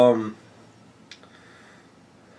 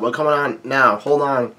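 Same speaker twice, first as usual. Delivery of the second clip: ordinary talk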